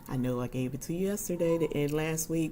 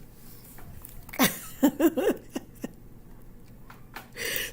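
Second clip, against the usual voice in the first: a high, airy burst of real laughter; high breathy laugh; 12 semitones higher than usual. Real, not acted. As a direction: let a breathless giggle escape. Laughing at a chat comment.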